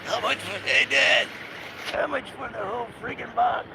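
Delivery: high imitated New Jersey voice, laughing through it; a redo of the New Jersey kid's call to his dad; New Jersey accent. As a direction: replay the kid's New Jersey line with glee. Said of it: "that New Jersey accent".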